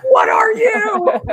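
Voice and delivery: high, loud laughing shriek; screaming bird. Retold or imitated. Cardinal screaming in her face, high loud shriek. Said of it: "screamed in my face".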